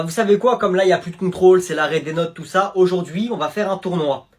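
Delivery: usual speaking voice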